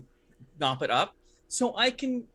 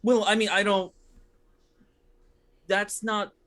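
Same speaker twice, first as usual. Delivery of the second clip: halting, higher-pitched protest with long pauses; flustered stalling; 7 semitones higher, 5 dB louder than usual. Acted. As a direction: stammer as if cornered, breaking off mid-thought. Cornered informant stalls under questioning.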